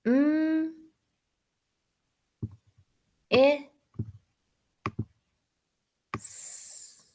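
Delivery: "Mm." "I." "S." each held apart, the last a soft hiss; sounding out miss. Shown as a demonstration. Teacher sounding out miss while writing it. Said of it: "Let's do miss".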